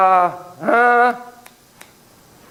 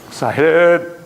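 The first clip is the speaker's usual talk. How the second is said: excited; 3 dB louder than usual. Shown as a demonstration. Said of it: "When I go".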